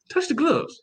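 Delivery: high, sing-song order; playful high-pitched coaching; mock instruction. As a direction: chirp it like a cheeky reminder. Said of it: "They probably gonna tell him".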